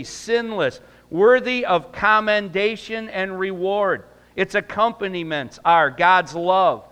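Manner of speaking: loud, high preaching cadence. Acted. Pastor preaching doctrine to the church, loud and high. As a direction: preach it out to the congregation with full force.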